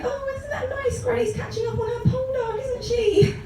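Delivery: high-pitched twee cooing; cooing admiration. Acted. Onlooker admiring granny in a period drama, cooing. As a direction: put on a sweet, very high admiring voice.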